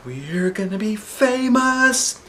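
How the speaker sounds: put-on high, slow, gushing girl voice; thrilled little girl bursting with excitement; excited. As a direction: squeal it out like an eager schoolgirl. Andrea excited in story.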